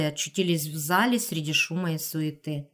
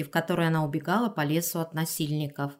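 ordinary delivery, nothing marked

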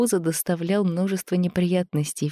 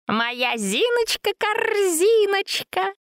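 high, slow tender coo; tender grunting; much slower than usual. A narrator's reading. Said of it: "нежно захрюкал Антон".

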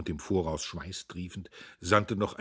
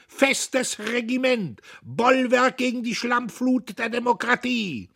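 very high, slow, overstrained shouting; rattling power slogans; 8 dB louder than usual. A narrator's reading. Dietrich shouts slogans over the din, bombastic. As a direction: hurl each slogan out at full stretch. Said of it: "Seine Kraftworte stießen rasselnd aneinander".

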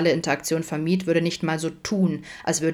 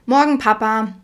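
put-on high, louder singsong child's greeting; bright child voice calling to Papa; fluting child; 5 semitones higher, 6 dB louder than usual. A narrator's reading. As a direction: flute it out like a little daughter. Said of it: "flötete eine helle Stimme hinter ihm".

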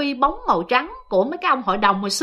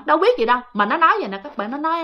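ordinary delivery

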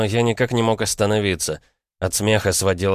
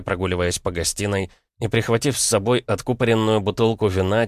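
ordinary delivery

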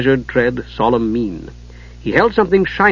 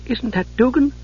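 higher Usha voice; 10 semitones higher, 4 dB quieter than usual. A narrator's reading. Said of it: "Usha said".

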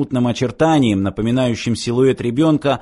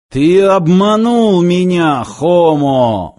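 slow, loud, raised roar; 5 semitones higher, 5 dB louder, much slower than usual. A narrator's reading. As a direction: roar it out, drawing the words long. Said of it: "взревел динамик".

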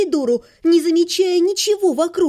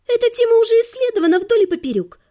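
higher, fast, brushing it off; dismissive; 9 semitones higher, faster than usual. A narrator's reading. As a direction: wave it away, quick and high. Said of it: "отмахнулась Лира".

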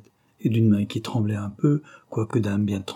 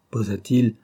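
usual speaking voice

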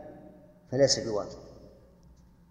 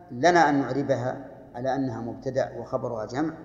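normal talking voice

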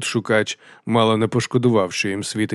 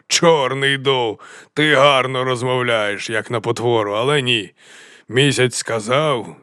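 slow, raised, stretched-out delivery; theatrical mountain chieftain; 2 semitones higher, 4 dB louder, slower than usual. Acted. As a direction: draw each phrase out with mocking grandeur. Kramok theatrically answers Dow.

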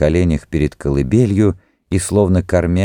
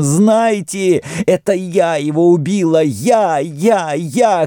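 very high hysterical confession; frenzied shouting; 11 semitones higher, slower than usual. A narrator's reading. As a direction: cry out your guilt to all, wildly. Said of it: "кричала она". (истерический крик высоким голосом)

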